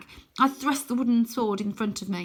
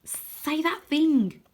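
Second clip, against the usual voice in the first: urgent plea; 8 semitones higher, 4 dB louder, much slower than usual. A narrator's reading. Wyn urging battle cry.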